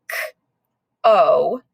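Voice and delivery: "k-o" said slowly as koh with a long o. Shown as a demonstration. Teacher sounding out "k-o" for the class. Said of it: "You're going to say".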